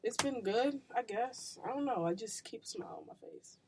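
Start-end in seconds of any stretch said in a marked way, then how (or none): 2.0-3.6 soft unvoiced whisper. Real, not acted. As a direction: whisper it to yourself. Whispered aside.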